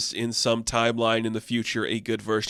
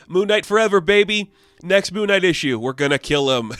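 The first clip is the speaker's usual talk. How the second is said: higher and louder hammed-up cheer; mock triumph; 7 semitones higher, 5 dB louder than usual. Vlogger mocking Mark's triumphant ending, hammed-up cheer. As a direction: cheer it, then deadpan the twist.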